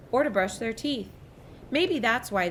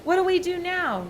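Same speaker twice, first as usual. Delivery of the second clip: high, louder panicked yell; frightened yell; 12 semitones higher, 4 dB louder than usual. A narrator's reading. The boy yells when lightning splits the mast, high yell. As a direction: yell it over the storm.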